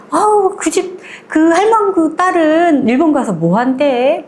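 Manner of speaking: high, nosy villager voice; gossiping neighbor. Retold or imitated. Village woman gossiping about grandmother's daughter, high and nosy.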